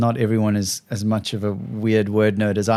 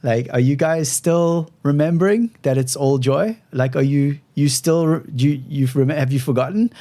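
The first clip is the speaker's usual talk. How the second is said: "remembering" and "forgotten" rising into questions; questioning; 4 semitones higher than usual. Retold or imitated. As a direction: pitch lifted on "are you guys still remembering", checking in. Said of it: "they'll sometimes say it like a question".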